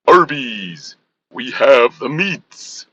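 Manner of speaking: slow deep ad announcer; booming movie-trailer pitchman, slowly; deep commercial voice. Pretend. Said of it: "I can talk really low".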